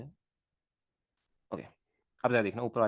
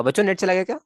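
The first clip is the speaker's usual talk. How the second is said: higher, louder alarmed call to the class; alarmed question; 8 semitones higher, 8 dB louder than usual. Real, not acted. Teacher calls out to students as the net drops, alarmed. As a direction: call out to the students in sudden worry.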